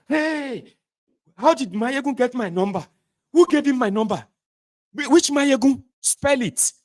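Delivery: a boss thrown into alarm by one name; panicked high shriek. Retold or imitated. To a staffer, learning Mayegun is calling: high frantic shriek. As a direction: voice shooting way up, loud and frantic.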